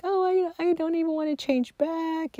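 high-pitched mock swooning delight; retold squeaky rapture; gushing customer. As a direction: gush it way up high, imitating a thrilled customer.

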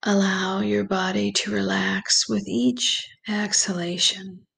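slow, calm meditation voice with long pauses; guided meditation. Acted. Guide leads listeners through breathing and visualization, slow meditation voice. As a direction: ease the listener gently into relaxation, unhurried and even.

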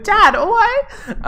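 very high, hammed-up girl squeal; panicked daughter. Daughter questioning dad as they flee, hammed-up panic. Exaggerated replay of the film daughter.

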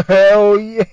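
loud, high laughing cheer; hyped cheer. Real, not acted. Co-host cheering that football season is coming.

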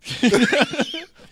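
loud high laugh. Real, not acted. Laughing at the joke.

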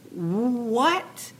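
drawn-out high rising question; big disbelief. Acted. Urging Joseph to seize his moment, hammed-up disbelief. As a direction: stretch it out, utterly incredulous. Acted bigger disbelief, repeated.

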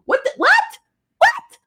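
very high, squeaky "what?"; incredulous. Acted. Reacting to his stupid excuse, incredulous. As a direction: squawk it in hammed-up disbelief.

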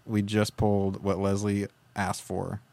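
ordinary talk, nothing marked

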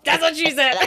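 high, loud laughing punchline shout; Michael Scott. Retold or imitated. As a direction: blurt it out laughing like a sitcom boss. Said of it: "like Michael Scott in The Office".

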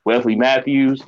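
usual speaking voice

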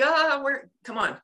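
mock frustrated groan into words; acted stressed searching. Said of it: "'cause you're stressed".

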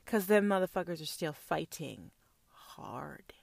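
a hushed conspiratorial whisper. Acted. Telling the audience about the Far East in a whisper. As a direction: lean in and whisper the secret.

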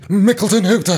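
Mickleton Hooter said like a sneeze. Pretend. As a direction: sneeze out the name. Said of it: "He's got an allergy".

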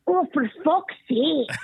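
put-on high, slow child voice with Scottish accent; little Scottish girl. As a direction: imitate a toddler swearing in a Scottish accent. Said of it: "she has a little Scottish accent".

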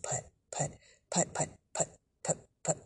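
soft whispered little putts; hushed, toneless chugging; sputtering car imitation. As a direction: puff out each putt under the breath. Imitating the car limping along.

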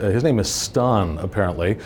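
ordinary talk, nothing marked

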